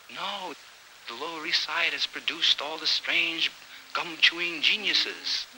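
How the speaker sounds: high, airy poet's voice; high affected poet. Acted. A poet bidding a dramatic farewell, high and airy. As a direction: lift it up, precious and theatrical.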